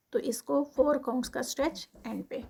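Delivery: usual speaking voice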